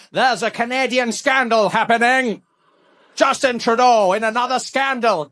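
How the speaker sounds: slow, measured mock news read; news anchor parody. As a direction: announce a scandal like a newsreader. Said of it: "I turn on the news".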